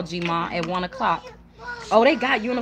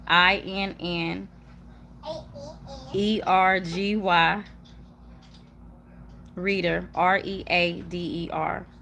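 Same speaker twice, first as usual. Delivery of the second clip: slow, letter by letter; spelling it out; slower than usual. Real, not acted. Streamer giving viewers her payment handle, spelled slowly. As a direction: space out each letter slowly.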